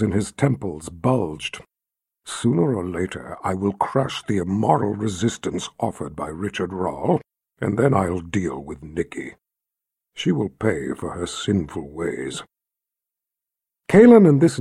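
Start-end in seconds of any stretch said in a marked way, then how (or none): 2.3-12.4 low rumbling emperor voice. Acted. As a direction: rumble like bottled thunder. Jagang threatens.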